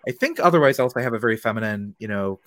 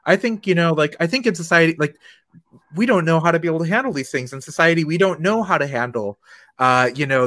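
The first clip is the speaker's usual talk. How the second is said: higher and louder, imitating a flustered, defensive apologizer; mock the stranger's over-eager excuses, pitched up high; defensive apology; 6 semitones higher, 5 dB louder than usual. Retelling the stranger's excuses after misgendering her, in a mocking higher, louder voice. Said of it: "started apologizing in a way".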